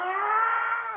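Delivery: short high-pitched imitation scream; sudden high yelp of a scream; mock scream. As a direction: imitate someone screaming in the background, high and loud. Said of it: "someone screams".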